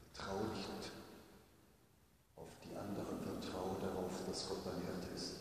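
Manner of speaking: soft inner whisper of trust; still small voice. Retold or imitated. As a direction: say it like a gentle inner voice. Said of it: "eine ganz andere Stimme, die viel stiller ist".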